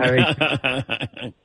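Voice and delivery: normal talking voice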